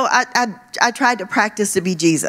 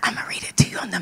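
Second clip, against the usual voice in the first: whisper; 9 dB quieter, much faster than usual. Real, not acted. Confiding about a funny translation.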